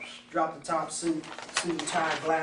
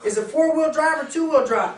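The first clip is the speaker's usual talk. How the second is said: pressing friend; 9 semitones higher, 6 dB louder than usual. Acted.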